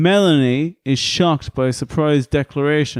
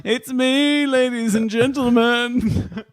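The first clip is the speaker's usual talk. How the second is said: announcer voice; 9 semitones higher, slower than usual. Pretend. Grand self-announcement as driver.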